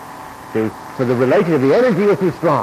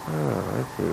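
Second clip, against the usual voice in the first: quiet realization; 9 dB quieter than usual. Retold or imitated. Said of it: "he's kind of like".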